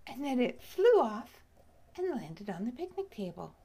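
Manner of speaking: usual speaking voice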